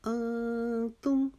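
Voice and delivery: انتم very slow, the noon hidden in the nose; ikhfa on noon. Shown as a demonstration. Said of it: "انتم میں ہم نے ایک الف کے جتنا اخفا کیا". (انتم میں نون کا اخفا، آہستہ تلاوت)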